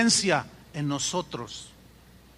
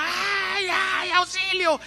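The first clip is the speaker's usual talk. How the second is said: high, drawn-out mock cry for help; imitated screams for help; 11 semitones higher, much slower than usual. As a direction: wail as the wife being hit, in retelling. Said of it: "la señora gritaba". (imitando gritos agudos de auxilio)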